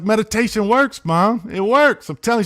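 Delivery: high, loud, exuberant reenactment; retold excitement. Son convincing mom that meditation got him a car, excited. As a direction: insist loudly, as if proven right. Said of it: "I was so excited".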